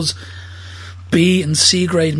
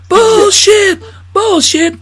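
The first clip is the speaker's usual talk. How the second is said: loud, much higher ranting; loud mock rant; 14 semitones higher, 6 dB louder, much slower than usual. Friend quoting Pryor's rant, loud high mock rant. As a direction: yell it high like a comic rant.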